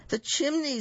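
very high-pitched piggy squeal. A narrator's reading. Second little pig spots the way in, squealing. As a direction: squeal it out in alarm. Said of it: "squealed the second little pig".

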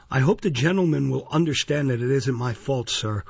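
ordinary delivery

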